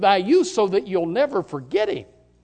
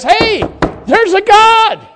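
loud, high, slow call; shouted announcement; 10 semitones higher, 7 dB louder, much slower than usual. Pretend. Preacher voicing saved souls shouting at us, loud. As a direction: proclaim it to the whole room. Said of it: "that is shouting at us".

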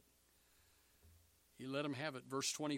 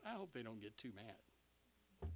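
low, very soft, slow aside; private thought; 5 semitones lower, 12 dB quieter, slower than usual. Acted. Elijah thinking about the angry prophets, hushed worried aside. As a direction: murmur it to yourself. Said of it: "I can imagine him thinking".

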